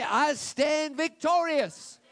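triumphant slow proclamation; shouted declaration. Shown as a demonstration. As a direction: bellow it as a victory cry.

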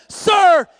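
loud, high command to one man; shouted order. Retold or imitated. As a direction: call him out over the crowd. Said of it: "he looked at a man and he said".

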